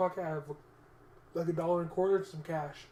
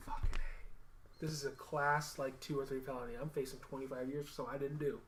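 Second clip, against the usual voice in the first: resigned mutter; 4 semitones lower, 7 dB quieter than usual. Retold or imitated. Hearing friend read his rights.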